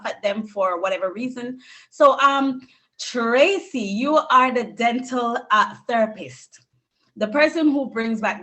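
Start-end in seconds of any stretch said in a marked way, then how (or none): none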